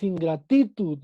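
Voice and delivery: "ingratitud" mal acentuado, ingratítud; acento en TI. Shown as a demonstration. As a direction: marca TI en "ingratitud", como si fuera grave. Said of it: "No tenemos la sílaba tónica en ti". ("ingratitud" con la penúltima sílaba tónica: in-gra-TÍ-tud)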